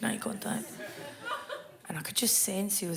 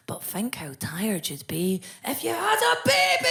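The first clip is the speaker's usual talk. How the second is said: hoarse whispered shout at herself; 4 semitones higher, 5 dB louder than usual. Retold or imitated. Telling herself off about being tired, whisper-shout. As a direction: scold yourself in a strained whisper-shout. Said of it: "I'll go".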